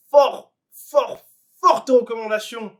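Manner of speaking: « Fort, fort » lancé très fort et haut; emphase forte. Real, not acted. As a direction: crier son enthousiasme pour l'anime. (le sensei recommande l'anime, d'une voix forte et haute)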